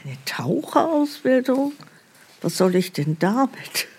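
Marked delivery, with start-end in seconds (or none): 0.0-1.7 ungläubig. Acted. Empörte Rückfrage im Sketch.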